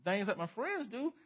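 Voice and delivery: a hint of a hammed-up self-righteous member; mock self-justifying churchgoer. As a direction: play a smug good person defending himself. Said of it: "We say".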